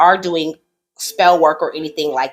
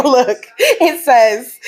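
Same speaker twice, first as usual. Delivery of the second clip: laughing; 8 semitones higher, 4 dB louder, much slower than usual. Real, not acted. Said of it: "they about to make me cry".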